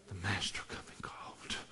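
very soft, breath-only whisper; whispered preaching. Real, not acted. Preacher saying the master is coming, whispered. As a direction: let it out as a hushed secret.

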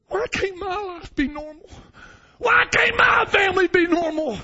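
high, rising to a cry; child asking God in bed at night, tearful; tearful plea. Retold or imitated. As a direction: plead through tears. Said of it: "and asking God".

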